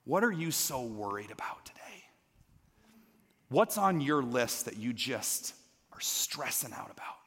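soft whisper of a gentle question; hushed whisper. Acted. Jesus gently asking the congregation their worries, whispered. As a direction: lean in and whisper it like a confidant.

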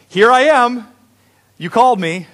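high, loud announcement; eager boy. A narrator's reading. Samuel wakes Eli a third time, eager boy.

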